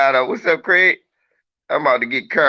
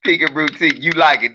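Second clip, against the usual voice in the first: normal talking voice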